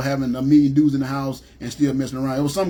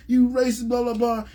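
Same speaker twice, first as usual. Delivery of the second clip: mock cursing out; 8 semitones higher than usual. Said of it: "I started cursing this dude out".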